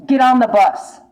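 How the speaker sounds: loud insistent urging; urgent call. Real, not acted. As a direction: shout the command to get going. Urging viewer to leave.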